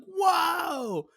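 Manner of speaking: high, drawn-out mock gasp of awe; amazed whoa. Teller voices their younger self shown a pricey guitar, amazed. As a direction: stretch out a high, wowed "whoa".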